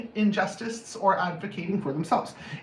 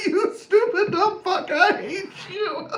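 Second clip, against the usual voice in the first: high, slow words through laughter; manic laughing rage; 12 semitones higher, slower than usual. Pretend. Demonstrating laughter as a remedy for anger, manic laughing insult. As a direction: cackle out the insult as mock hatred.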